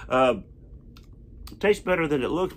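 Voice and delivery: talking between bites; chewing while talking. Real, not acted. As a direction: mouth partly full. Seen 0: Lips move closed between words; bitten bar held near mouth.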